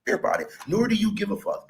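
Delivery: normal talking voice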